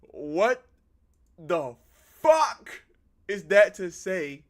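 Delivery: loud, word-by-word disbelief; appalled outburst. Real, not acted. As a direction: punch out each word at high volume. Appalled at the husband's line.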